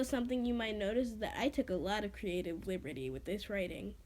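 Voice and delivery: ordinary delivery, nothing marked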